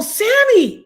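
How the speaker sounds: high, loud call across a street; urgent high-pitched yell. Retold or imitated. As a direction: shout the name to catch someone leaving. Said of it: "And I call out to her".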